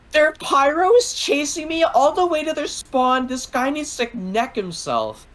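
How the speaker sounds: imitating a high-pitched panicked scream; mock scream. Teammate screaming over voice chat in a match, mock high scream. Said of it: "screaming from the top of her lungs".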